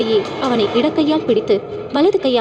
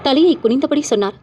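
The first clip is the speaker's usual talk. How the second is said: ordinary delivery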